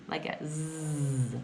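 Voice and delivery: slow buzzing "Z" with voice; z sound. Shown as a demonstration. Teacher demonstrating a voiced "Z". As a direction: stretch the "Z" into a buzz. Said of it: "pronounced like a Z".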